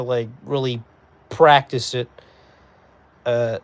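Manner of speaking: usual speaking voice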